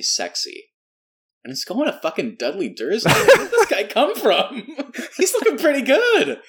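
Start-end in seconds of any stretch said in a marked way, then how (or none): 3.1-6.4 higher, louder, laughing through it; delighted laughing disbelief; laughing gush. Real, not acted. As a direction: gush and giggle at the surprise crush. Gushing over Dudley, laughing.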